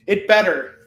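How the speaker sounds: ordinary talk, nothing marked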